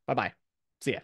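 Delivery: ordinary talk, nothing marked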